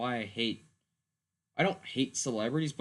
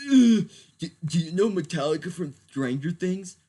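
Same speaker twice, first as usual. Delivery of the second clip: mocking clueless fan; 6 semitones higher, 9 dB louder than usual.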